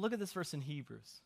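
normal talking voice

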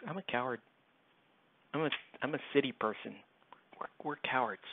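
small, quiet admission of cowardice; 弱気な口真似. Acted. Sheepish mock reply to buddy's pledge.

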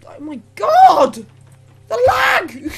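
loud, drawn-out wail of complaint; exasperated shout. Real, not acted. Gamer hit by lag, exasperated shout. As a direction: stretch it slowly into a high yell. Game lags, player cries out.

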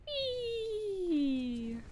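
high drawn-out whee; gleeful whee. Real, not acted. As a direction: glide down with glee. Gliding in game.

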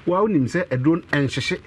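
ordinary talk

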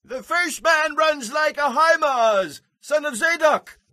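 watchman calling down. A narrator's reading. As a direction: report from the lookout at a distance.

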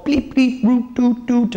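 little robot beeps and toots; imitated robot bleeps. Reviewer mimicking the wordless robot film, bleeps and toots. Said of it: "and stuff like that".

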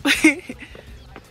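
short bright laugh. Real, not acted. Vlogger laughs at her sweat joke, a short laugh. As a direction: laugh at your own silliness.

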